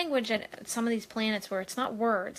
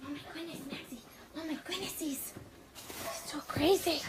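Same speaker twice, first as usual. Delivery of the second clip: cooing at the dog; 6 semitones higher, 7 dB quieter than usual. Real, not acted. Greeting the dog Maxie.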